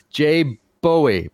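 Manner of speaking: Bowie said slowly as BOH-ee; knife-style pronunciation. Shown as a demonstration. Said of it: "like the Bowie knife".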